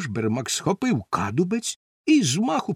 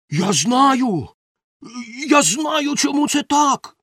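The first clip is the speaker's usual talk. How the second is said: high, urgent outburst; excited shouting; 8 semitones higher, 3 dB louder, slower than usual. A narrator's reading. Helper thinks he's found who is to blame, excited shouting. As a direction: shout it as a sudden realisation. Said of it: "закричав Бошбермак".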